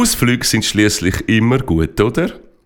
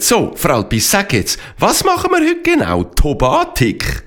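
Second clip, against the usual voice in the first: curious pony voice; 11 semitones higher than usual. Acted. Esteban asks Fralpi what's planned.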